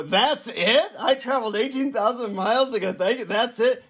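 high-pitched, mock-outraged disbelief; incredulous protest. Seeker protesting to the guru after a long trip, incredulous. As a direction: voice jumps up in comic incredulity. Acted disbelief at the guru's answer.

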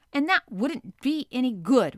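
Ned brushes the idea aside; gruff retort. Acted. Ned to Conseil, rejecting the universal language, gruff retort. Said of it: "replied Ned Land".

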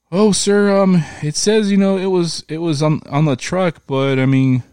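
high, breezy lady agent voice; mock phone agent. Mocking the FedEx lady to his cohost in a high voice. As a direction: pitch way up and play her careless.

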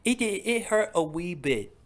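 hammed-up British lilt; mock British accent. Continuing the bit in a mock British accent. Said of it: "our terrible English accents".

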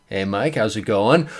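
low, soft, offhand greeting; playing it cool. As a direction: toss it off casually, quietly. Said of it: "I might try to play it cool".

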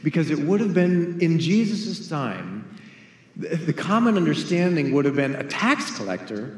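ordinary talk

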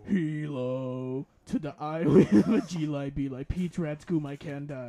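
low, slow, deep gravel voice; talking corpse. Acted. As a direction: drop low and drag it out slowly. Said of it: "but in corpse husband's voice".

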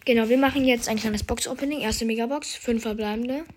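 ordinary talk, nothing marked

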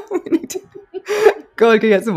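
laughing through the words; amused laugh breaking into the line. Real, not acted. Joking about crow's feet.

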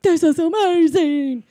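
high, loud, slow squeal of delight; mock childlike thrill. Imitating the rabbit kids getting a bow, thrilled. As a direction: gush like an overjoyed kid, hammed up.

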